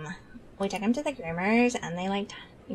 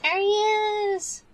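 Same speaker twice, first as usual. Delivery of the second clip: much higher, louder, slow and drawn out; excited reveal; 13 semitones higher, 5 dB louder, slower than usual. Real, not acted. Showing chat the dog's picture, excited and drawn out. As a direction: announce the dog's photo with glee.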